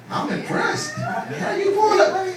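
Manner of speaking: very high squeaky disbelief; mock amazement. Comic asking Mac about his girl, mock amazement. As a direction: stretch it out in a high falsetto.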